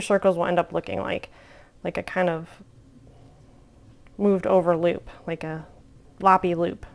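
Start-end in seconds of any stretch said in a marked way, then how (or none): none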